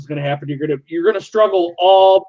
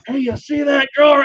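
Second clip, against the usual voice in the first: put-on loud, pitched-up drunken call to a buddy; 10 semitones higher than usual. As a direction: call it out high and tipsy across the party. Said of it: "And I'm kind of being loud too".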